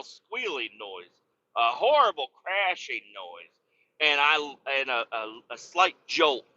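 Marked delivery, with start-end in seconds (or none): none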